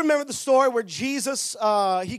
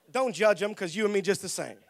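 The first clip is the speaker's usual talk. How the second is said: ordinary talk, nothing marked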